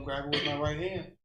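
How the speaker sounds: ordinary talk, nothing marked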